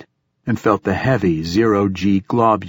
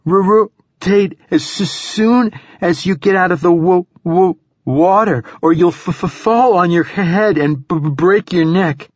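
shivering, stuttering with cold; high, loud, stammering from the cold; teeth chattering; 10 semitones higher, 4 dB louder than usual. Acted. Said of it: "teeth chattering now despite her efforts".

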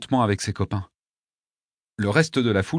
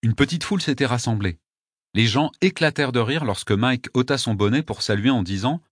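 ordinary delivery

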